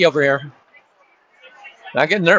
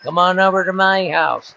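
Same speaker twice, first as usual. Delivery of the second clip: higher, slow, admittedly terrible fighter impression; Nate Diaz impression; 6 semitones higher, slower than usual. Announcer attempting Nate Diaz's post-fight party invite, bad impression. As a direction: try the brash post-fight invite, badly. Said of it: "I can't even do a Nate Diaz impression".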